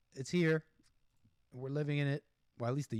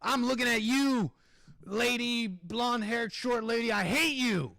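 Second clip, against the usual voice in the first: hammed-up outburst, higher and louder; mock insult rant; 6 semitones higher, 4 dB louder, slower than usual. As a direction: spit out mock insults, piece by piece. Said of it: "even if she said".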